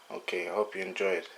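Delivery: ordinary talk, nothing marked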